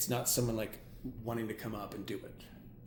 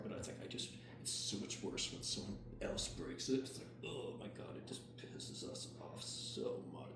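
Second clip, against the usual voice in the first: whispering; 2 semitones lower, 9 dB quieter than usual. Real, not acted. Hypnotist softly speaks during induction.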